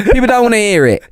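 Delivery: usual speaking voice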